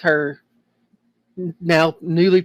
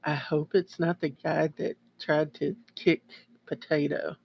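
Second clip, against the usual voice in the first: hushed, softly voiced aside; quiet whisper; 8 dB quieter than usual. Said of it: "I just whispered to Ava very quietly".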